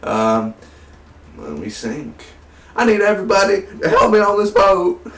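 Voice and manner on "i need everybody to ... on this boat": high, strained politician impression; Bill Clinton. Reviewer joking about an actor's accent, hammed-up Clinton impression. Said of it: "That was my Bill Clinton right there".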